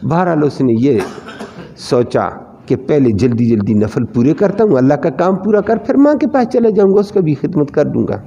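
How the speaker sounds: ordinary talk, nothing marked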